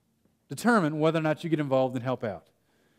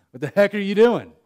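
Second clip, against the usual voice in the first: louder alarmed yell up the ladder; a son yelling at his dad up a ladder, louder; retold yell at dad; 4 semitones higher, 8 dB louder than usual. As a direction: call up to him in alarm.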